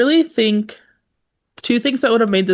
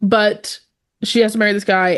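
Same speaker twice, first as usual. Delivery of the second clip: ordinary talk, nothing marked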